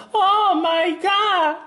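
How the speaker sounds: very high, slow, squealed oh my God; panicked anime girl. Retold or imitated. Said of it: "Y diciendo". (chillido agudo y lento de chica anime)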